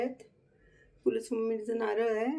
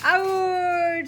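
long drawn-out, high shout of "out"; playful game call; 6 semitones higher, 3 dB louder, much slower than usual. Real, not acted. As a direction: stretch it out like an umpire calling to a pet. Calls the dog out in game.

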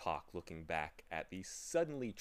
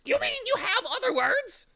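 very high, loud, delighted squawk; happy bird cry; 13 dB louder than usual. A narrator's reading. Bird crying happily at Milo's words, high and loud. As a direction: pounce with glee as a high-voiced bird. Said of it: "cried the bird happily".

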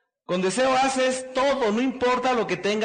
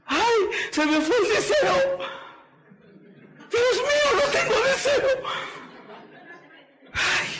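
high, wailing mock despair, much higher; mock singer's lament; 13 semitones higher than usual. A singer who lost his voice, waking up: high mock wail. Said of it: "como el que canta y dices".

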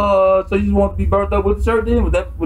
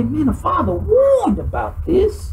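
higher and slower, struck by it; awed realization; 6 semitones higher, slower than usual. Shown as a demonstration. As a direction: say it like the warning just came true. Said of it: "we say".

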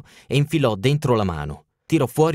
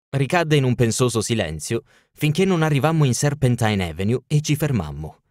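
usual speaking voice

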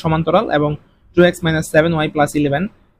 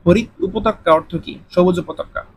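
ordinary talk, nothing marked

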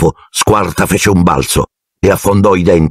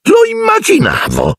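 aunt Marge voice. A narrator's reading. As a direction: look down your nose at the boy's family. Aunt voiced in read-aloud story.

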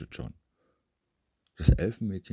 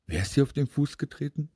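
high, quick questioning mother; concerned elf mother; 9 semitones higher, 4 dB louder, faster than usual. A narrator's reading. Elf mother asking who trod on the foot, urgent. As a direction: ask sharply high and fast.

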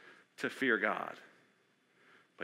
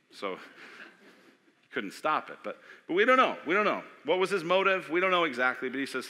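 normal talking voice

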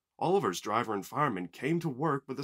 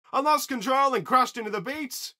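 high, loud and urgent; loud explaining; 13 semitones higher, 8 dB louder than usual. A narrator's reading. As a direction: call up your explanation to the crew. Stranded surfer explains his crash.

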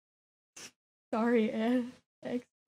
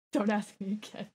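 soft, lower, voiced murmur; quiet refusal; 4 semitones lower, 10 dB quieter than usual. Real, not acted. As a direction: say it low and softly. Refuses quietly, first take.

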